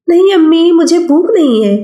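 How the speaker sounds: put-on young boy. Child Afaq voiced by narrator.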